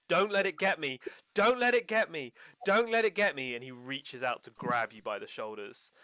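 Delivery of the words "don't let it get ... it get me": high, loud screaming plea; terrified scream. Acted. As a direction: scream it right in their face. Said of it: "he screams in your face".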